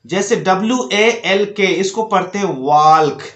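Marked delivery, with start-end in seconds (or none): 2.6-3.2 वाल्क with the l sounded; wrong pronunciation. Shown as a demonstration. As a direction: say वाल्क with an audible l before k. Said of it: "जो कि बिल्कुल गलत है".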